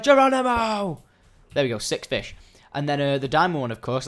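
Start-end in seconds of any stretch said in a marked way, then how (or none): 0.0-1.0 high, loud, drawn-out cry; leaping fish's battle cry. Retold or imitated. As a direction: holler it like a daredevil leaping. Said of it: "It was like".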